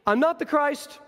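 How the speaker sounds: lightly voiced John, fed up; curt, weary brush-off. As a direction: brush them off, tired of the question. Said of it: "his answers keep getting shorter".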